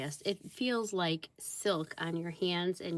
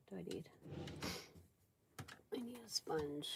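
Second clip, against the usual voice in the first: whispering; 13 dB quieter, faster than usual. Real, not acted. Muttering to self while working.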